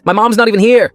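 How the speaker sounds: loud, high-pitched protest; indignant protest. A narrator's reading. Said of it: "I protested".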